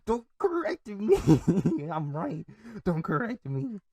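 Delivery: high, drawn-out whiny protest; mock annoyed. Nephew objecting to uncle's correction, mock annoyed. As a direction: protest like a stubborn kid, breaking into laughter. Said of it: "and I get annoyed".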